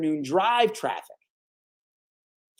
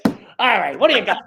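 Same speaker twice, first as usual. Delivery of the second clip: boss voice; 4 semitones higher, 8 dB louder than usual. Retold or imitated. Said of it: "He's like".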